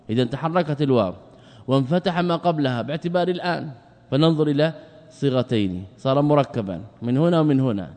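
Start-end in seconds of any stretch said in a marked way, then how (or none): none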